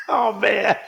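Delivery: real laughter breaking into the words; laughing. Real, not acted. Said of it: "if you wonder why I'm laughing".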